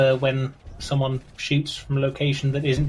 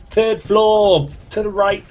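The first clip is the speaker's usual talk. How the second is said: louder, higher mock battlefield call; 7 semitones higher, 6 dB louder, slower than usual. Player demonstrating a callout of where the fire is, calling out. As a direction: call out a position to squadmates. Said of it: "call out to".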